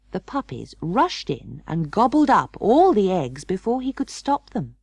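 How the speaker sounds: ordinary delivery, nothing marked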